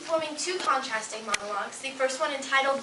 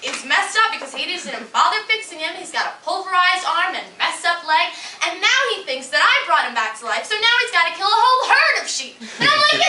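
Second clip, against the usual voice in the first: louder, ranting comic goddess, building higher; exasperated young goddess; 6 semitones higher, 9 dB louder than usual. Acted. Goddess complaining about revived priest Andrew, exasperated. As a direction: rant with mounting exasperation.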